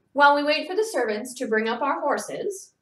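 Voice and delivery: bright and cheerful, a lift in the voice; a gracious hostess sounding delighted; happy hostess announcing to guests; said happily. Acted. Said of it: "she said happily".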